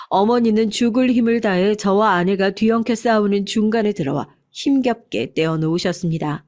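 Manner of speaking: usual speaking voice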